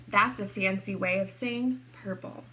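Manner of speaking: ordinary delivery, nothing marked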